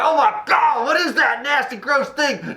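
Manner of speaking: high, squealing mock disgust; shocked young lady. Retelling Dracula's reaction, a high mock squeal of disgust. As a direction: squeal like a startled girl. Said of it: "She smack it away, like".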